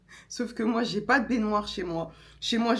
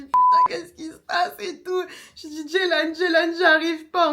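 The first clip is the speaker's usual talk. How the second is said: higher, tearful, louder panic; panicked crying; 9 semitones higher, 5 dB louder than usual. Retold or imitated. Woman in labour panics and cries out to Jelan, tearful and higher. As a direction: call for help half-sobbing.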